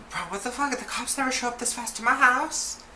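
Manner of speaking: high-pitched questioning disbelief, climbing as it goes; incredulous. Retold or imitated. Narrator reacting to cops arriving fast, incredulous.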